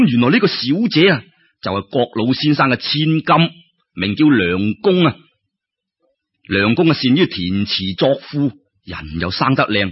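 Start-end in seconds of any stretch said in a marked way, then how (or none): none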